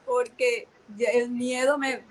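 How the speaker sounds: ordinary talk, nothing marked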